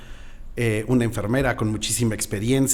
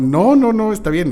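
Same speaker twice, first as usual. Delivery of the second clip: much higher, quick no's; flustered refusal; 10 semitones higher, 5 dB louder than usual. Retold or imitated. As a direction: politely brush it off, flustered. Refusing beer, embarrassed.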